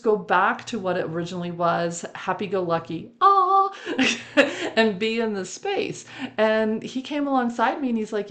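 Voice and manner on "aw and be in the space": cooing aw. Retold or imitated. Cooing about happy herd.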